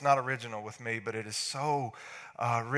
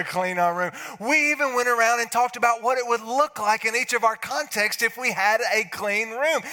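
put-on loud, higher, eager kids' excuses; excited kids; 4 semitones higher, 6 dB louder than usual. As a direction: pile on the proud excuses. Kids excuse themselves to dad.

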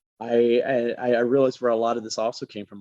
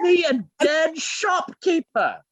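high, slow indignant posh British voice; posh British accent; 4 dB louder, slower than usual. Pretend. Joking about Basil Fawlty, an indignant posh British voice. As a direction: huff like an outraged British shopkeeper. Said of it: "Basil Fawlty is in their voice".

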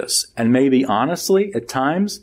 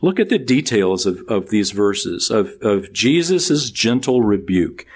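normal talking voice

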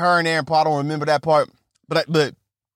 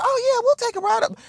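put-on much higher, airy and eager girl; high mock woman's voice; faster than usual. A comedian mimicking the actress agreeing to the ride, pitched up high. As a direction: squeak it out like a naive tourist girl. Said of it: "Emily Ratajkowski's like".

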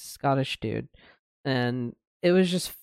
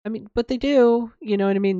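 higher and louder, real indignant insistence; emphatic outburst; 9 semitones higher, 6 dB louder than usual. Real, not acted. Speaker protesting how school taught Native history, loud and indignant. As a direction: push back hard, they still exist.

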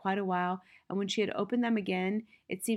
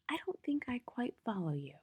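whispered, breathless and puzzled; a hushed, voiceless question; 4 semitones higher, 7 dB quieter than usual. Acted. Puzzled teacher speaking softly.

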